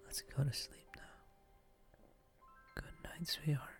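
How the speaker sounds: ordinary delivery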